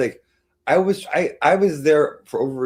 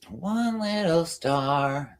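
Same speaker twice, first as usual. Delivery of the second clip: very slow, higher Big Bird sing-song; 8 semitones higher, much slower than usual. Shown as a demonstration. Streamer demoing her Big Bird voice for chat. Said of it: "That's my Big Bird voice".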